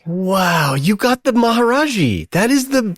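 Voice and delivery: real thrill rising in the voice; excited wow. Real, not acted. Listener delighted by the guru's answer, excited and high.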